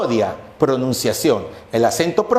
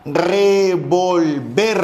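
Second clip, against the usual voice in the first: revolVER drawn out syllable by syllable, weight on VER; slow "revolver", stressed on the last syllable, ver; stress on final syllable; much slower than usual. Shown as a demonstration. Language teacher showing students where the accent falls, revolver stressed on VER. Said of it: "la mayor fuerza de voz".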